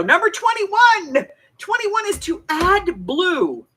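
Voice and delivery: high, drawn-out call-out; sing-out announcement, slow and high; announcer call. Pretend. Calling out numbered instruction to audience.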